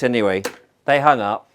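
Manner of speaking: ordinary talk, nothing marked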